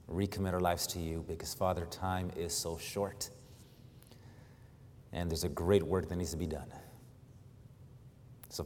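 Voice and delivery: hushed, reverent pulpit prayer. Real, not acted. Leads the congregation in prayer.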